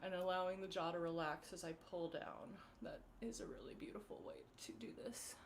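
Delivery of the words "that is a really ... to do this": whisper. Real, not acted. Quiet aside during face massage.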